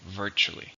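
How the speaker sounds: Virtually, stressed on VIR; first-syllable stress. Shown as a demonstration. Teacher models Virtually for learners, stress on VIR. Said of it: "stress on the first".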